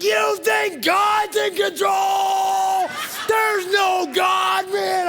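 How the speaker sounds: high, drawn-out enraged scream; screaming outburst. Retold or imitated. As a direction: scream it as a furious rant, stretching the words. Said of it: "I screamed as loud as I could".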